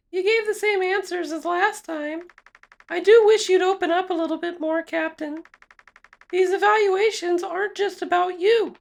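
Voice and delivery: high feminine character voice; high female voice. Acted. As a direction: play the evaluator up high. Voicing female psych evaluator.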